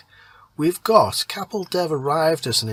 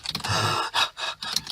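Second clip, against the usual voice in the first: breathless unvoiced gasp for air; 5 dB quieter than usual. Out of breath from running, messenger gasps before speaking. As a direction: suck in a short winded gasp. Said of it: "he gasped".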